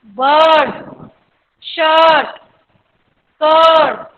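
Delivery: long drawn अ in बर्ड, शर्ट, कर्ड; long vowel. Shown as a demonstration. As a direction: stretch the uh in each word, r silent. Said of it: "और लंबा अ है". (बर्ड, शर्ट, कर्ड में लंबा अ)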